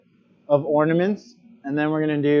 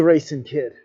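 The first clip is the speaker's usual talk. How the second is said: ordinary delivery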